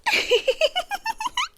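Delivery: high, breathy little giggle; a teasing high laugh; soft amused giggle. Acted. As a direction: giggle softly at his nerves. Amused at his nervousness.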